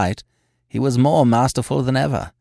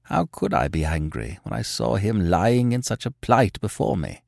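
ordinary delivery, nothing marked

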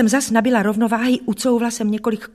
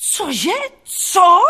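high, incredulous yell, louder on the second word; escalating shocked shout; roared; 10 semitones higher, 6 dB louder than usual. A narrator's reading. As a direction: bellow in outraged disbelief. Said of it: "zařval".

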